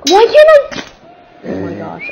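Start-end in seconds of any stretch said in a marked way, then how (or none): 0.1-0.8 very high, loud protest; frustrated wail. Real, not acted. Gamer failing a move, frustrated wail. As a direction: shriek it in frustration.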